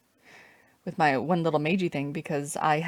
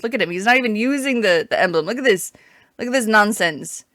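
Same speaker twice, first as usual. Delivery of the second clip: louder, higher, outraged; indignant outburst; 3 semitones higher, 9 dB louder than usual. Real, not acted. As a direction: complain loudly at the screen. Indignant at opponent.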